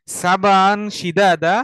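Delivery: very slow phrase-by-phrase chanted Arabic; recite to repeat. Shown as a demonstration. Teacher leads students to repeat after him. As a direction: chant each phrase slowly for students to echo.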